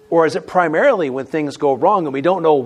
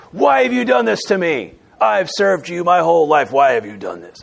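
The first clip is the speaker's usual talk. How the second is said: accusing God; 3 dB louder than usual. Shown as a demonstration. Lifelong servant confronting God, loud and aggrieved. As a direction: protest loudly, running down in pitch by the end. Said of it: "Same words, different tone".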